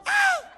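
very high shrieking mock scream; little girl scream. Pastor imitating Josh's little-girl scream, very high shriek. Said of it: "it's like a little girl".

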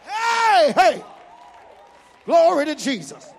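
loud, rising sermon shout to the congregation; preaching at full force. Acted. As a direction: drive it home like a preacher whipping up the church.

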